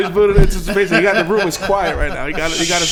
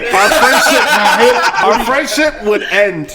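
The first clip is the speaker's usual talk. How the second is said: mock dramatic; 4 semitones higher, 5 dB louder, much slower than usual. Dramatic slow mock warning.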